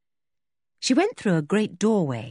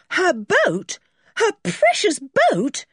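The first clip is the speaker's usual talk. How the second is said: high-pitched outraged alarm; alarmed cry; 12 semitones higher, 4 dB louder, slower than usual. A narrator's reading. George fearing for her boat, alarmed cry. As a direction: cry out in rising dismay. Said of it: "George gave a scream".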